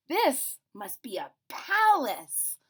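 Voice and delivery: slow, loud, high breathless wonder; gasping in awe. Acted. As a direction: gasp at the grandest sight ever. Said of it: "Corduroy gasped".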